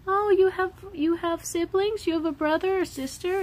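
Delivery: high, bright questioning voice; curious stranger. Retold or imitated. Stranger asking the daughter about siblings, high and curious. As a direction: ask sweetly like a curious grown-up. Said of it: "when people ask her".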